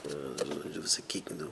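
unvoiced whisper, very soft; hushed aside. Same aside about old news. (שטיל געשעפטשעט)

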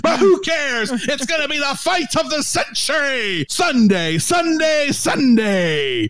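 booming hype-man promo; Sunday Sunday Sunday announcer; wrestling commentary. Pretend. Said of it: "the wrestling commentary".

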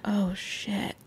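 under-breath oh shit. Retold or imitated. Filming his crying fiancée on the bridge, a soft startled curse. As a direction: let it slip out quietly in alarm. Retelling seeing her crying hard.

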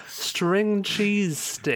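drawn out, very slow triumphant finding. Real, not acted. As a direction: stretch each word out slowly, word finally found. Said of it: "That's the fucking word I'm looking for".

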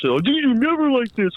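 high, hammed-up apology; high mock reassurance; mock excuse. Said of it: "of course".

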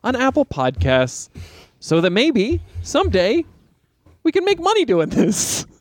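excited. Real, not acted. Pitch climbing excited plug.

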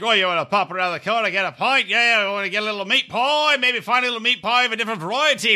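hammed-up British bloke accent. Imitating fraternity brother Dan's British accent, hammed-up. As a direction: do a cheeky British pub lad. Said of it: "Dan was like".

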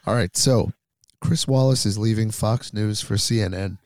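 ordinary talk

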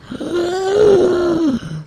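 long, high-pitched wheezing gasp; asthmatic gasp. Acted. Host struggling to breathe after getting angry, asthmatic gasp. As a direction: suck air in high and drawn out. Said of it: "Oh, my asthma's rough".

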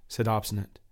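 usual speaking voice

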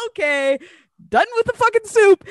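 high, loud, fed-up cry; exasperated outburst. Retold or imitated. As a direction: throw the spoon down and announce you're done. Disgusted at goat jaw in soup.

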